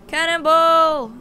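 high, loud, drawn-out holler; cannonball yell. Pretend. Player leaping toward the water, long loud holler. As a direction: yell it long as you jump.

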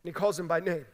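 ordinary talk

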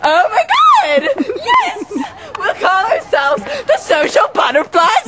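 excited high squeal, laughing; hammed-up gushing friend; mock squeal. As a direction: shriek it like a giddy teen. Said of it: "And Michael was like".

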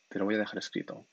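ordinary delivery

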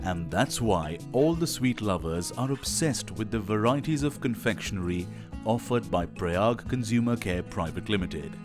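normal talking voice

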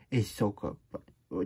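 ordinary delivery, nothing marked

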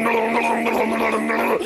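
ordinary delivery

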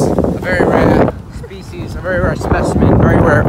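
loud, excited pitch of a rare find; hammed-up nature host. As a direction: announce it like a thrilled presenter.

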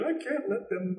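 high-pitched, softly imitated agitated protest; very animated. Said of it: "she's getting very animated".

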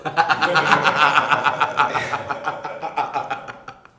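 a long real burst of laughter; real laughter trailing off. Real, not acted. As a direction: crack up at your own story. Speaker cracks up at own story.

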